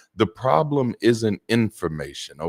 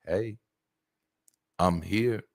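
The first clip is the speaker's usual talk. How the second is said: much softer, hushed and close; soft whisper; 5 dB quieter than usual. Shown as a demonstration. Parent comforting an upset child up close, hushed whisper. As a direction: lean in and murmur it gently. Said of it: "a whisper".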